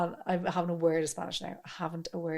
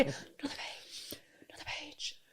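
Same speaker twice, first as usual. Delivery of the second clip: imitating a classmate in a whisper; hushed, unvoiced mock request; whispering like a swot asking for paper; 5 semitones higher, 17 dB quieter than usual. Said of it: "Ask for another page".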